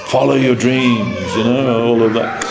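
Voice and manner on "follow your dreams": slow, amused mock of a slogan; drawn-out teasing catchphrase; mocking quote. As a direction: say the slogan with a gentle laughing scorn. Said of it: "very popular to say that nowadays".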